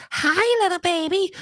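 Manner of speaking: put-on high, sing-song voice for little kids; a doctor greeting kids in a squeaky cartoon voice; funny baby-talk voice. Shown as a demonstration. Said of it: "in these funny little voices".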